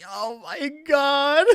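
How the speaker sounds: slow high oh my God dissolving into laughter; laughing near tears. Real, not acted. Fan overcome by a sweet scene, teary laugh. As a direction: let it break into a teary laugh.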